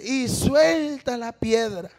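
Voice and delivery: very slow, drawn-out preaching; skinny preacher's line. Retold or imitated. Retelling the preacher's David story, slow drawn-out delivery. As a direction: stretch SUELTA out long, imitating the preacher. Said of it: "Y dice".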